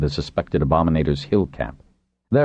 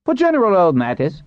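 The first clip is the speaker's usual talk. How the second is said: quick remark in a much higher voice; quick interjection; 3 dB louder than usual. A narrator's reading. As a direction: cut in briskly, pitched up. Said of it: "Pinch said quickly".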